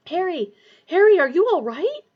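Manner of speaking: loud, high worried call; alarmed shout. Acted. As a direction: call him back urgently. Friend shouting to fainted Harry.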